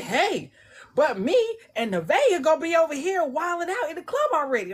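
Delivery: high, bright playful character voice; teasing character barging in; character Aaron's voice. Retold or imitated. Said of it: "But Aaron over here like".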